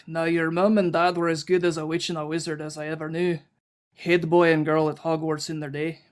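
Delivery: loud, hearty Scottish-Irish accent; booming Scottish-type character voice; Hagrid. Shown as a demonstration. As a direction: put on a big Scottish brogue. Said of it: "you doing a Scottish Irish accent in Hagrid speak".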